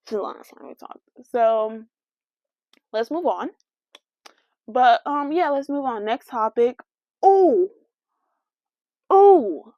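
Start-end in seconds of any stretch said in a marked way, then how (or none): 0.1-1.0 unvoiced whisper, under the breath; whispered repeat. Real, not acted. 7.2-9.7 loud, high sudden "Oh!"; suddenly remember something big; sudden realization. Real, not acted.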